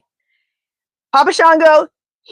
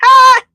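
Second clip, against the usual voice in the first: high loud shriek, much louder; excited shriek; 7 dB louder than usual. Real, not acted. Psychic reacting to a viewer's Epstein question with a shriek. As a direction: squeal in shock.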